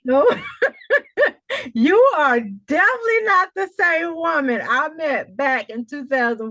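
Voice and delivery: loud, high burst of laughter and joy; delighted coach, laughing as she praises her student. Real, not acted.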